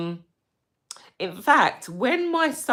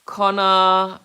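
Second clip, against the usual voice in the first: ordinary talk, nothing marked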